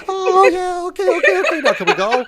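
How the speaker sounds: very high, loud imitation girlfriend; put-on falsetto, eager to be done; mock girlfriend. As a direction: squeal a hurried, hammed-up acceptance. Acts out freezing girlfriend's reply.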